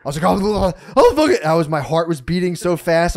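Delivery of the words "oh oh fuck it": high, loud flustered outburst; retold stuttering rage. Storyteller replaying his spaz at the man, loud and stuttering. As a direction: sputter it, re-enacting blind fury. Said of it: "I'm, like, stuttering".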